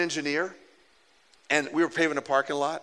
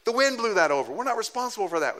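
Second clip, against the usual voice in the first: raised, insistent denial; defensive reply; 7 semitones higher than usual. Retold or imitated. Pastor telling the engineer it was the wind, raised and insistent. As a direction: brush the blame off firmly. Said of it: "He said".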